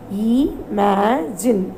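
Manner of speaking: drawn out imagine very slowly; slow demo. Shown as a demonstration. Teacher to grade five, models the word very slowly. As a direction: stretch Imagine out slowly for the class.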